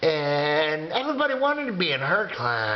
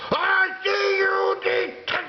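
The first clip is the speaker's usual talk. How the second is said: mean teacher shout; 13 semitones higher than usual. Acted. Mean teacher catching kids in the hallway, shrieking. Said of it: "she'd go".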